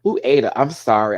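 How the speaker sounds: usual speaking voice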